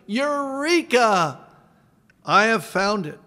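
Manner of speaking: loud, high exclaimed Eureka, slowed; shout. Retold or imitated. Preacher voicing Archimedes shouting in the bathtub, loud. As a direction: cry it out like a joyful discovery. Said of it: "he shouted".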